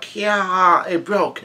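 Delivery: high-pitched mock squeal without extra volume; retold shriek. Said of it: "one of them yells out".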